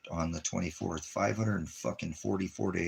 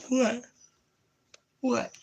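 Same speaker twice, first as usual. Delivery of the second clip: very high, louder squeaky "what"; high-pitched pet talk; 5 dB louder than usual. Real, not acted. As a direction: pipe it up high to the dog. Talking to his dogs.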